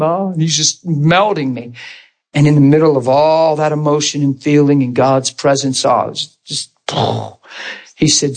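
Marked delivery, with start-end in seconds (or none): none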